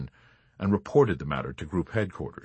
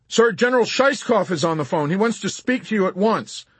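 high, raised reporting voice; corporal's loud report over the commotion; urgent corporal; 10 semitones higher, 4 dB louder than usual. A narrator's reading. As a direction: announce it urgently to a superior officer. Corporal reporting to panicking general.

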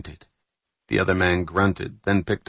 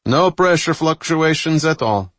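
high, raised-voice status report; calling out; 8 semitones higher, 5 dB louder than usual. A narrator's reading. Engineer reports pressure during speed run, calling out. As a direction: call the reading out across the room. Engineer calls out gauge readings.

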